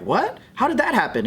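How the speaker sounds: ordinary delivery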